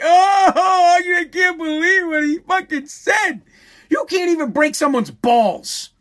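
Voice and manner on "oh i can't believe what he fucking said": high, slow whining cry of outrage; mock offended whiner. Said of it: "They go".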